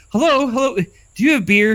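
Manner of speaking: high calling voice. Acted. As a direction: call out hello from afar. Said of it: "I already called him".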